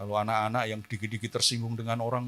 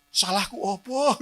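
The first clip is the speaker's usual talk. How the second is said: mock sulky child; 10 semitones higher, 5 dB louder than usual.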